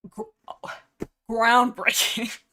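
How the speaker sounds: slow, loud sarcastic drawl. Real, not acted. She mocks the prophecy with a slow, loud sarcastic groundbreaking. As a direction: stretch it out, dripping with sarcasm.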